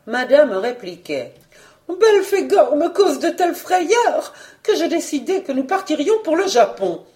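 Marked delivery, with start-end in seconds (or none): 1.9-7.0 high, indignant baroness; high-pitched agitated wife; angry hen. Acted. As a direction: squawk back like an angry hen. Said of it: "telle une poule en colère".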